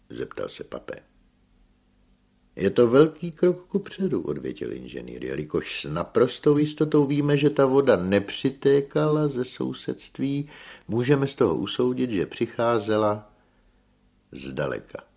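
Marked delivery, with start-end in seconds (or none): 2.6-4.2 loud, high declaration; raised lecturing voice; emphatic engineer. A narrator's reading. Engineer answers a sceptical question. 5.2-15.0 loud, high explanatory voice; emphatic scientific reasoning; engineer arguing his case. A narrator's reading. Engineer argues his deduction.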